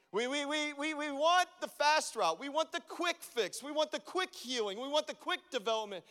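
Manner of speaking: building preacher's cadence, pressing each want; preaching. Acted. Pastor tells congregation he will preach, rising sermon cadence. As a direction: preach it, stacking the lines. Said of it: "Can I just preach for a moment?".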